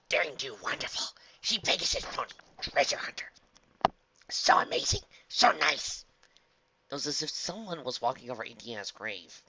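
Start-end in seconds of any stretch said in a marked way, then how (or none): 0.1-6.1 high, breath-heavy gushing dog voice; beaming admiration. A narrator's reading. Dog praising the treasure hunter, beaming. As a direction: gush loudly about a beloved hero. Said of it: "A wide smile beamed across Flint's muzzle".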